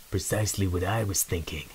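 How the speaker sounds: calm stealthy stranger; quiet unshaken mouse; steady. Acted. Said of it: "a steady but unexpected voice".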